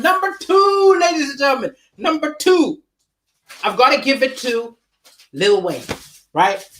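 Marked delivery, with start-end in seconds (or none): none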